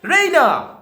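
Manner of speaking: high loud call across the house; calling Raina. Shown as a demonstration. Mother calling for Raina. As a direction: call the daughter's name from afar. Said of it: "she pronounces it".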